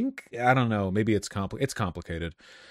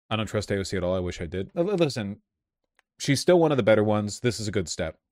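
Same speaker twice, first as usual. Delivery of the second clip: ordinary talk, nothing marked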